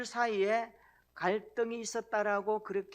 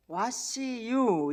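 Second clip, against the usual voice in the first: puzzled foreigner; 5 semitones higher, 7 dB louder, much slower than usual. Retold or imitated. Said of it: "외국인이 굉장히 놀랐어요".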